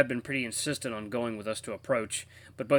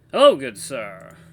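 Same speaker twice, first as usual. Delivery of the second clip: louder, slow Southern greeting; Southern accent; 13 dB louder, slower than usual. Shown as a demonstration. Player testing a voice for the radio, Southern accent. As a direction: try out a Southern voice, drawn out. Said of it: "like a southern voice".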